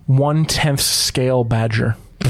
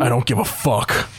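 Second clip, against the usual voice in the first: gruff huffing badger; 3 semitones lower than usual. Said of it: "talking in weird badger voice".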